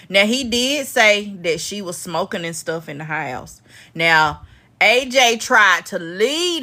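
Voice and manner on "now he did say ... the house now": ordinary talk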